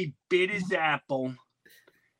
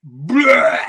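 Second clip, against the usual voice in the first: mock groan; 6 semitones higher, 7 dB louder than usual. This apple went.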